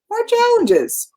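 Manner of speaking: jumps way up high on the answer; high squeaky punchline; mock surprised answer. Real, not acted. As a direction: toss it off in a high, mock-bright voice. Punchline answer to own question.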